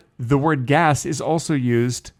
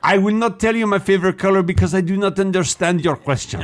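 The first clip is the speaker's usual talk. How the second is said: put-on higher-pitched French-accented refusal; put-on French accent in English; 6 semitones higher than usual. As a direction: refuse stiffly as a French person. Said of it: "a French person trying to speak English".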